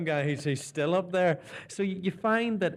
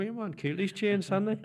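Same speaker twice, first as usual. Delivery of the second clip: broad Belfast voice, a touch higher; Belfast local; 7 semitones higher than usual. Retold or imitated. Belfast locals teasing the returning presenter in broad accent. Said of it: "people go".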